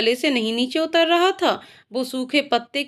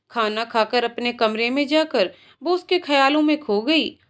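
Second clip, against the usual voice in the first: ordinary delivery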